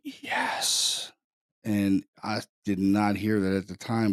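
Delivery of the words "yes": drawn-out whispered yes, imitating a ghost; ghostly male voice. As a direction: whisper it like an eerie voice on a recording. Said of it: "Sounds like a male voice. It's like".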